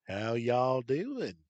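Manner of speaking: put-on loud, drawn-out hillbilly accent greeting; Southern hillbilly twang, sauntering in loud. Thief mocking the hero as he steps out, hillbilly accent. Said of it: "in his best hillbilly accent".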